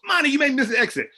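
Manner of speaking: high, fast playful complaint; re-enacted high-pitched grumble; mock scolding. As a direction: exclaim to your kid in comic dismay. Mock scolds daughter over missed exit.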